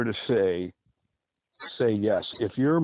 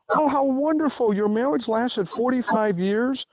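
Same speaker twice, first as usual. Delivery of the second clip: mock gushing admiration; 11 semitones higher than usual. Mock admirer of old marriage.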